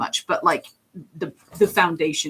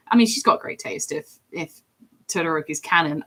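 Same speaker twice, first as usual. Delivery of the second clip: ordinary talk, nothing marked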